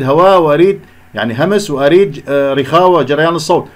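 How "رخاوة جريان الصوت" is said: ordinary talk, nothing marked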